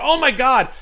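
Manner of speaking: high, loud shriek of shock; shocked scream. Retold or imitated. Recalling the backseat shock with a loud high shriek. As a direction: shriek it out, horrified. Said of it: "and I just went".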